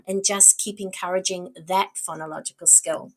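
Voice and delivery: normal talking voice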